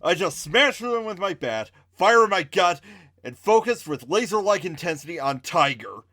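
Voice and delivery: ordinary delivery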